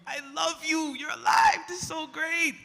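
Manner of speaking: high, loud shouts of delight; joyful shout. Acted. Peter answering Jesus, joyful shouting. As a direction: shout it out, bursting with joy.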